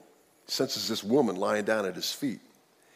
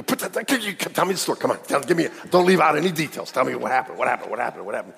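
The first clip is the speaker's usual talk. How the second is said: eager Naomi; faster than usual. Retold or imitated. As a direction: burst out, begging for every detail. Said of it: "you go".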